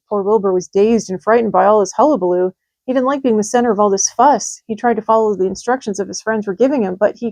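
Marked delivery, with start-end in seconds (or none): none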